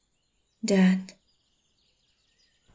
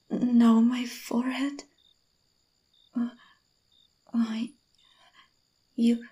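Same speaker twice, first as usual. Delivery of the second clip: stunned whisper; 5 dB quieter than usual. Acted. Just kissed on the cheek, stunned whisper. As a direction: breathe it in shock.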